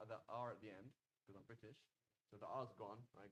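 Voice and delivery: usual speaking voice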